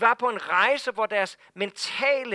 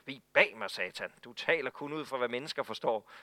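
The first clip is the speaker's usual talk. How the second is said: low, firm, curt rebuke of a friend; blunt rebuke; 7 semitones lower, 5 dB quieter than usual. Retold or imitated. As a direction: cut him off flatly, no softening. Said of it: "sådan en kontant afregning". (kontant, lav irettesættelse)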